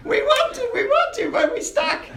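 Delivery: very high, squeaky goblin plea; stuck goblins. Acted. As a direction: whine in falsetto like trapped little goblins. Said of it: "And they go".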